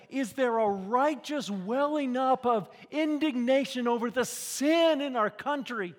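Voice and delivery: loud, high preacher's call; preaching at full force. Acted. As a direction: thunder it from the pulpit.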